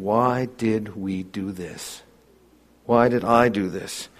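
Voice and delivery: soft, slow, rueful question; hushed regret. Retold or imitated. Sinner looking back on his wrong, hushed regret. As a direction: ask yourself quietly what you've done.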